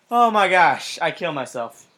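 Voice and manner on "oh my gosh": ordinary talk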